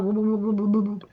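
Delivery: low, soft stuttered "boo-boo" shivering; mock cold shiver. Hates going out investigating in the cold, mock shivering. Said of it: "I'll be like".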